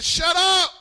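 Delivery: preacher voicing a stern order; commanding rebuke. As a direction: silence a storm with one sharp call. Jesus silencing the storm, acted.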